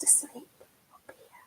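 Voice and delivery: whispered, hushed. Real, not acted. Telling the children the spider sleeps, whispered. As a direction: whisper as if not to wake her. Spider falls asleep at night.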